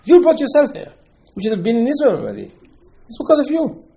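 high, loud acted rebuke; indignant accusation. Moshe answers the complaining people, high indignant accusation.

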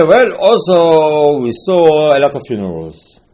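slow, loud, put-on whining voice; complaining spies. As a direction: drag it out like a worried scout. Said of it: "And then they say".

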